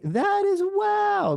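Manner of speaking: voice going up. Real, not acted. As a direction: voice climbs very high, excited. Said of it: "my voice is going up".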